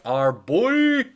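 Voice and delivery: slow, loud and stretched out; drawn-out hype. Acted. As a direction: stretch out our boy with hype. Mocking the "fearless leader" title.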